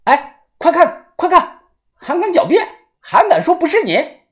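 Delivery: high, agitated pointing-out; worked-up, high-pitched triumph; excited accusation. A narrator's reading. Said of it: "豆芽仔一看激动了起来".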